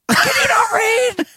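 high, slow incredulous scolding; mock outrage. Dad scolding daughter at the alarm, outraged. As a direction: imitate a dad in exasperated disbelief.

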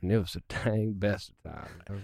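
southern drawl. Shown as a demonstration. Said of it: "throw a dang or something there".

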